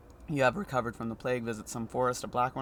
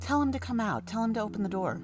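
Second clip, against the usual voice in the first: high, pressing command; insistent high voice; 10 semitones higher than usual. Acted. Man behind the door urging Clara to make him come out, high and insistent. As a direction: demand it sharply. Voice demands.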